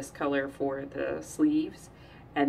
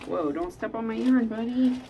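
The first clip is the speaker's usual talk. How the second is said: warning; 7 semitones higher, 5 dB louder than usual. Real, not acted. Warning child near yarn.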